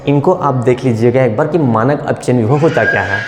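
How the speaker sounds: ordinary delivery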